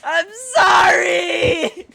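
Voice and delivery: very high, loud, slow fake-crying shout; mock wailing apology. Host wails a fake tearful apology to his co-host. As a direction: ham up a sobbing plea for forgiveness.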